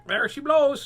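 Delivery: high, loud parrot squawk. Acted. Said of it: "squawked the parrot".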